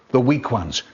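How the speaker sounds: ordinary delivery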